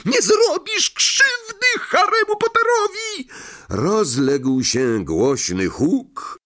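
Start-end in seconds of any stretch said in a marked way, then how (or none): 0.1-3.3 very high, squeaky elf shouting protectively; elf yell. Acted. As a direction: shriek to protect Harry. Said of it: "Zgredek wrzasnął".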